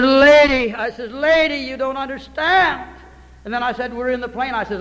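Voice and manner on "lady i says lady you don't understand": loud, urgent "Lady"; calling out. Retold or imitated. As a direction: call her up short, slowly. Preacher calls to the woman.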